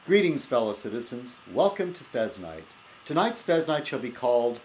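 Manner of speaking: hammed-up host greeting; show host intro. Host welcoming viewers to his show, mock grand. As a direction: announce it like a grand show opener.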